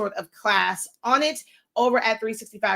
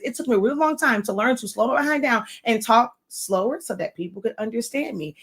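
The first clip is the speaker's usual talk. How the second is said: fast run-on delivery; sped-up natural talk; faster than usual. Shown as a demonstration. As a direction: hurry through it. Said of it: "I'm talking like this".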